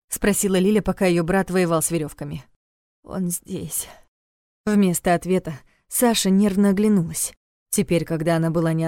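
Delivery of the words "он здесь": soft, quieter frightened warning; frightened hush. A narrator's reading. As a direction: warn them in a frightened hush. Frightened captive warns.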